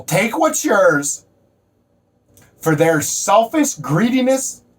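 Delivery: loud, high-pitched rallying sermon; fired-up motivational preaching. Real, not acted. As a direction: preach it at full force, leaning on key words.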